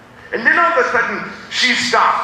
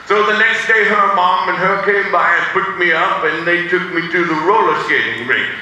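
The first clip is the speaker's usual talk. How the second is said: normal talking voice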